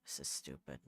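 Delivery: a low, quiet, mock-angry grumble; mock fury, held in. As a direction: mutter it low like you're done with everything. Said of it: "Can't you hear by the sound of my voice?".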